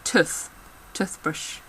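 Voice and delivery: Tooth and Toothbrush with "oo" as in foot; short book vowel in Tooth and Toothbrush. Shown as a demonstration. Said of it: "I say it and my sister doesn't".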